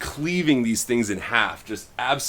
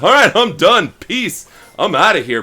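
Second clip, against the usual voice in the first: higher, louder breezy quitting; flippant exit; 8 semitones higher, 7 dB louder than usual. Retold or imitated. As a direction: toss it off and bail out. [name] bails out of the fight.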